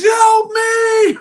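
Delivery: very high, loud mock scream; hammed-up yell for rescue; panicked cry. Sinking Peter calls to Yeshua for help, mock panicked cry. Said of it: "he starts to doubt and fear".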